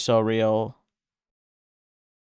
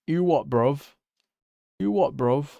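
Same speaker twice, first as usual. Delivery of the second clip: put-on British accent. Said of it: "I am British".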